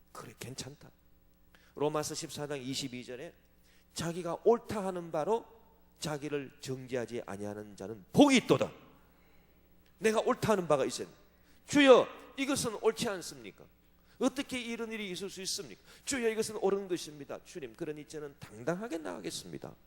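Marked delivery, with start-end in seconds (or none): 0.1-0.9 soft self-reassurance. Acted. Inner voice excusing self. 11.7-19.7 bold, confident prayer. Acted.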